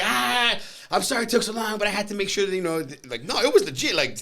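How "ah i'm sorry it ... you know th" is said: high, loud relieved apology; cheerful apology. Retold or imitated. Friend apologising to narrator for the long wait, high and cheery. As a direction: play the friend laughing it off.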